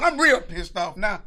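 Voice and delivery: normal talking voice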